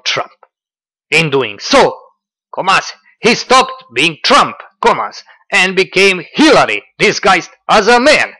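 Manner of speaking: loud, high, theatrical reading; hammed-up Dugin dictation, punctuation included; mocking Dugin quote. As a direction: proclaim it like a pompous philosopher. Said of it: "Let's see".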